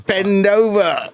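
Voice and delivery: high, drawn-out mock command; Blackadder character. Quoting Blackadder for a laugh, high drawn-out command. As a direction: stretch it out high and hammed-up.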